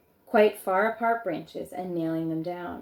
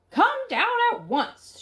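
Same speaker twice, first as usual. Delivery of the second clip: much higher called command; calling up; 4 dB louder than usual. Acted. Grandmother calling the boy down from the tree, high firm call. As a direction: call him down firmly from below. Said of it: "she called out".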